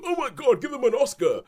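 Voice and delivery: high, loud, gushing fan overdoing the praise; breathless mock rave for a so-so performance. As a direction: squeal it like a starstruck fan. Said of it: "and they go".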